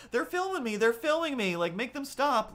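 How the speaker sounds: very high mock hysterical complaint; imitated screaming. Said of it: "she's still screaming".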